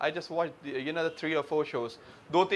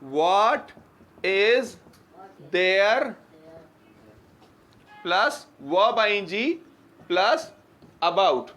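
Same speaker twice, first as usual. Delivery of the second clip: slow dictation; 4 dB louder, much slower than usual. Shown as a demonstration. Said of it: "जुमला बोला है".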